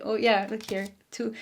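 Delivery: ordinary delivery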